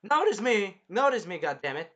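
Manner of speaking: high, loud whiny begging for attention; hammed-up cry for attention; mock desperate plea. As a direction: beg to be noticed like a jealous girl. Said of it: "she's basically, like, saying".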